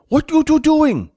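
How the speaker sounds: high, drawn-out yell; impatient shout. Acted. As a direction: holler at two dawdlers, high and loud. Fat yells at the lingering pair.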